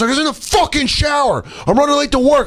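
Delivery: high, exasperated rant; furious shouting. Retold or imitated. Man late to work berating driver, furious high shout. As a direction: yell your grievance at him.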